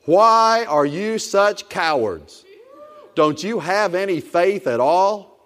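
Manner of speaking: slow, measured stern rebuke; Jesus rebuking his frightened disciples, slow and stern; stern reprimand. Acted. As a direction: drag out each word like a disappointed teacher. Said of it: "Jesus reprimanded the disciples".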